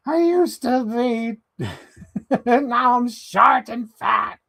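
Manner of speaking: high and slow, mock lament; Nathan Lane figure voice. The figure complaining about being short, slow high whine. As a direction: whine it slowly like a cartoon sidekick. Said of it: "Voiced by Nathan Lane".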